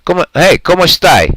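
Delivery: mock Italian-Spanish hello; playful imitation of a friend's greeting; imitating Anthony. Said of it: "He says".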